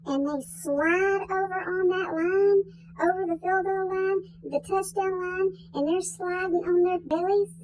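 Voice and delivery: normal talking voice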